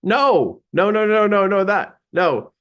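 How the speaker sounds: high-pitched, flustered protest; flustered denial. Retold or imitated. Speaker protests to audience calling him psychic, flustered denial. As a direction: deny it insistently, pitch jumping up.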